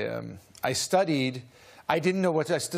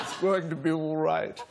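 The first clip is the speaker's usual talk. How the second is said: lightly imitated British thespian; British accent. Sonnet reader reassuring his embarrassed admirer, British accent. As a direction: put on a British actor's accent. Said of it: "a very British-sounding fellow".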